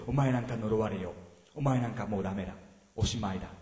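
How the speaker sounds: 低く抑えた、呪うような敵の声; 囁くように見下して脅す; 敵の声. Retold or imitated. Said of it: "敵の声です". (聴衆に敵の声を演じてみせる、低く冷たく)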